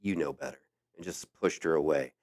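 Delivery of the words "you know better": low, soft retold reproach; lightly voiced showgirl chiding under the breath; showgirl's gentle scolding. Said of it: "she put her hand on that woman and said".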